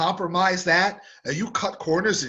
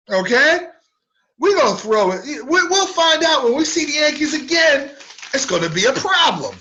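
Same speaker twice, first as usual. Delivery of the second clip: mock tough-guy threat, higher and louder; gangster talk; 8 semitones higher, 5 dB louder than usual. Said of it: "he's out there talking like a gangster".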